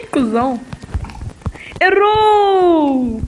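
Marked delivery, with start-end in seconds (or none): 1.8-3.2 long high mocking shout; sing-song taunt at the miss. Real, not acted.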